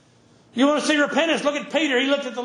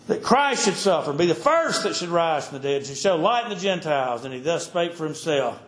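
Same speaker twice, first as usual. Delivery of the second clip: preacher reads Acts to his congregation, solemn and heavy; scripture preaching; 8 semitones lower than usual. Acted. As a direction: preach the passage with gravity.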